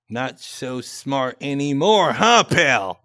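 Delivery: sneering mock shout of his own words; mocking loud jab. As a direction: throw his taunt back at him, mockingly. Mocking quote of the other's jab.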